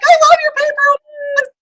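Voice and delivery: high, loud gushing praise. Retold or imitated. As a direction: gush over it. Friends gushing over the award.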